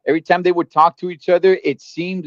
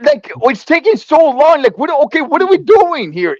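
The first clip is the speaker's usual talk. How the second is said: exasperated; 8 semitones higher, 4 dB louder than usual. Real, not acted. Bored impatience ranted.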